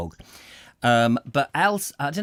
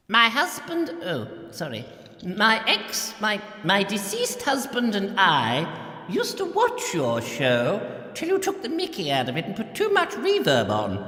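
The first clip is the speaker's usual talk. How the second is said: put-on much higher, plummy elderly monarch lament; the Queen; 5 semitones higher than usual. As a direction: play a lonely old queen begging for attention. Queen impression continues.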